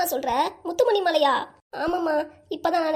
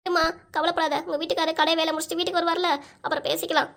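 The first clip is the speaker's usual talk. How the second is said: ordinary talk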